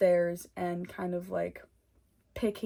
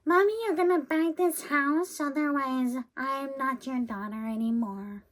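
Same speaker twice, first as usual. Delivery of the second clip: put-on high, demanding bratty kid voice; bratty child; 7 semitones higher, 4 dB louder than usual. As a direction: whine like a spoiled child. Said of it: "Not in the sense of".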